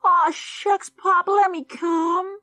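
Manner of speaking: very high, slow, drawn-out whine; whining boy. Acted. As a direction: spoilt son begs his father. Junior keeps whining at Pop.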